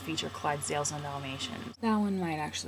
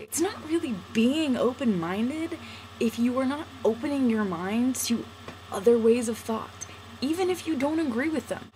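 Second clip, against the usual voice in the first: climbing higher and louder as the point builds; impassioned rant; 5 semitones higher, 4 dB louder than usual. Real, not acted. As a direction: preach it with growing fire. Passionate rant about open minds.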